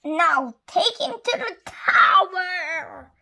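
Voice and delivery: drawn-out shouted decree; queen's order. A narrator's reading. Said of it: "ordered the queen".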